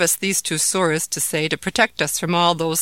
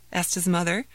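ordinary delivery

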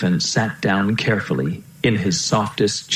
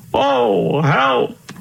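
high, wailing cry for help; distressed cry. A narrator's reading. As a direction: wail it like a frightened old king. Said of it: "cried the king".

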